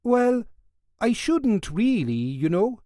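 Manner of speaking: a Welsh guard tempted but dutiful; high Welsh voice. Acted. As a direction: Welsh guard hesitating over leaving his post. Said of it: "in his high Welsh voice".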